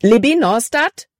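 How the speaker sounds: high, urgent call of the name; a touch of alarm, rising at the end; panicked. A narrator's reading. Caleb calls to sobbing Libby, panicked. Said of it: "Calebs Stimme war panikerfüllt".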